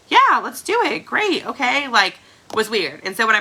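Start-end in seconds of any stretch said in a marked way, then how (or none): 0.1-1.9 a high burst of eager agreement; mock excited yes. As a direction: toss off a bright, surprised yes. Retelling her reaction to new joins.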